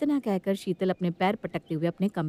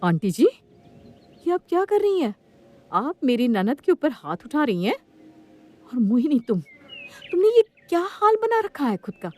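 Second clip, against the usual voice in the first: high, loud, appalled questioning; shocked; 8 semitones higher, 5 dB louder than usual. Acted. Sister-in-law catches the aunty hitting her, shocked and outraged. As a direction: confront them in disbelief. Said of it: "ऐसे अत्याचार होते देखकर हैरान हो जाती है".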